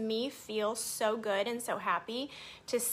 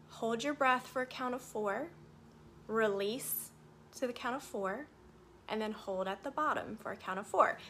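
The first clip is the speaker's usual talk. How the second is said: guided meditation. Acted. Guides square breathing.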